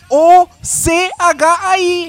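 loud, high, slow letter by letter; emphatic spelling out. Shown as a demonstration. Brother proving the document spelling, loud and slow letter by letter. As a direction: hammer each letter separately.

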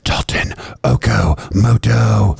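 big slow show intro voice; radio announcer. Acted.

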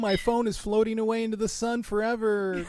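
high, slow, hammed-up distress; mock melodrama. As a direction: fake a doomed call dropping away. Mock excuse to end call.

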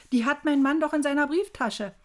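loud, high cry of dismay; entsetztes Rufen. A narrator's reading. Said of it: "rief ich entsetzt". (laut und entsetzt ausrufen)

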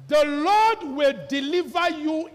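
high, loud, slowed declaration; David vowing before the battle. Acted. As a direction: pronounce it like a sentence. David's vow.

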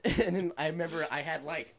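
usual speaking voice

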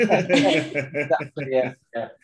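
laughing. Real, not acted. Laughing agreement about hammer joke.

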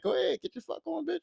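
soft, high-pitched mock indifference; dismissive. James letting her go with another man, quietly dismissive. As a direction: wave her off under the voice.

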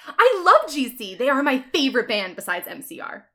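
loud, high burst, easing off; fangirl gushing. Acted. Ebony tells Draco she loves the band, gushing. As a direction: gush loudly about a favorite band. Thrilled fan after concert news.

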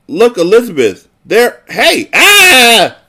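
rising panic that breaks into a shriek; terrified scream. Acted. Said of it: "I couldn't help screaming".

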